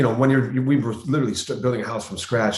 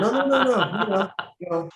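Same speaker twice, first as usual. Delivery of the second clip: laughing protest; 10 semitones higher than usual. Retold or imitated. Laughing refusal of shirt pull.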